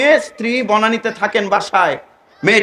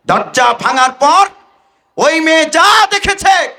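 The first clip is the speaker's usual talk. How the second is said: slow, climbing suspenseful declamation; dramatic preaching; 8 semitones higher, 3 dB louder, slower than usual. Acted. Preacher revealing what the girl saw, dramatic. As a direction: build to the shocking reveal.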